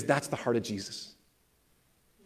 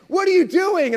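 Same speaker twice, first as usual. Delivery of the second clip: high, loud indignant mock shout; retold angry outburst; 9 dB louder than usual. Frustrated man confronts intruding kids, angrily. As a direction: act out his outraged challenge. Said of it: "he was just frustrated and mad and angry".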